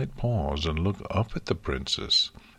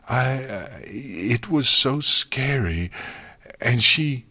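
sobbing; 6 semitones higher, 3 dB louder, slower than usual. A narrator's reading. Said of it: "Twilight sobbed and heaved".

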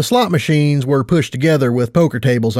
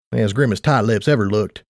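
usual speaking voice

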